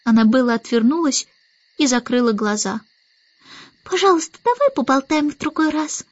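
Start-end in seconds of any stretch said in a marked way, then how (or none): 3.8-6.1 put-on high little-girl request; pleading baby Annabel. A narrator's reading. Baby Annabel puts off the starling, high pleading voice. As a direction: plead high, like a small child. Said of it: "попросила она".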